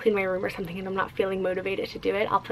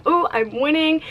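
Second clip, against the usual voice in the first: higher, louder self-congratulation; lightly acted little victory cheer; pleased exclamation; 6 semitones higher, 5 dB louder than usual. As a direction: quote your own delighted reaction, pitched up. Quotes own pleased reaction to outfit.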